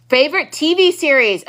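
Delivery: normal talking voice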